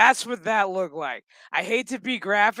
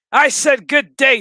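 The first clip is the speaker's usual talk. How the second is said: loud, higher mock-indignant "Good day"; 5 semitones higher, 10 dB louder than usual. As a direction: shout "Good day" like a huffy aristocrat dismissing someone. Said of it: "have a good day".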